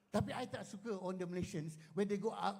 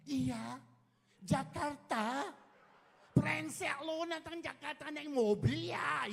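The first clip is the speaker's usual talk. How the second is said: put-on Indonesian accent, higher and drawn out; mock Jakarta Indonesian accent; 9 semitones higher, 4 dB louder, slower than usual. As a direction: slide into Indonesian speech to fit in. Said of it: "kita tukar jadi macam Indonesian".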